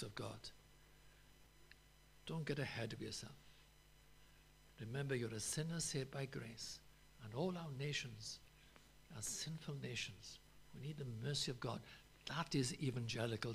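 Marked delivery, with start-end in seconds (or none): none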